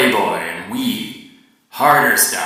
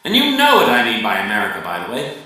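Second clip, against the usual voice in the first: ordinary delivery, nothing marked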